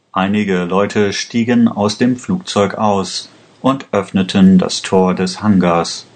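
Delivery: usual speaking voice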